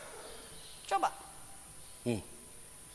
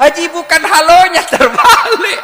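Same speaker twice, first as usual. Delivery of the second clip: very high, loud comic correction; exasperated shout; 17 dB louder than usual. Retold or imitated. Teacher correcting Haji's backwards hello, exasperated mock shout. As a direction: shout it in disbelief, stretching the last word.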